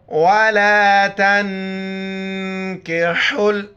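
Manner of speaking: slow drawn-out tilawat; Quranic recitation. Shown as a demonstration. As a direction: chant the phrase slowly. Teacher recites the verse. (धीमी तिलावत)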